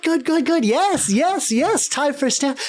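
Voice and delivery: high, gleeful teasing clown; happy clown voice. Acted. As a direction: cackle and tease in a high clown voice. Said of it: "She's like".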